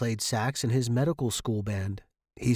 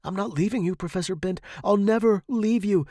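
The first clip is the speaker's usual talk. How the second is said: high, rising vow; desperate insistence; 9 semitones higher, 5 dB louder than usual. A narrator's reading. Student to dying professor, desperate. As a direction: build to a loud, high promise.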